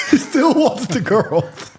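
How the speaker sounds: high-pitched, laughing through the words. Real, not acted. Reacting to the brother asking for girls mid-fight, laughing. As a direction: crack up while saying it. Amused aside inside the fight story.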